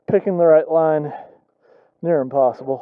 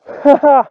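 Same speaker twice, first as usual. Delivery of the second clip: delighted laugh; 9 semitones higher, 6 dB louder than usual. Real, not acted. Laughing after clearing rocks.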